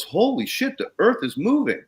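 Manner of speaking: high, astonished exclamation; old man amazed. Retold or imitated. Old neighbor staring at the moving soil, high and amazed. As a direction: act out the old man's amazement. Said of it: "the old man looks down and goes".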